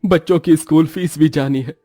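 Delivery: high, strained voice of a desperate father; distressed father. Acted. Father telling his wife he lost his job, high and anguished. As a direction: pour out a jobless father's despair, voice climbing.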